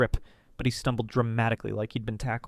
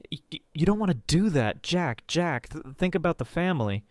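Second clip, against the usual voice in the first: panicked stammer; 6 semitones higher than usual. A narrator's reading. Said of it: "Julian panicked".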